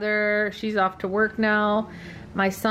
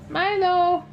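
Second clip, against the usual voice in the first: calling out; 11 semitones higher than usual. Real, not acted. Owner calling her dog across the room, high call.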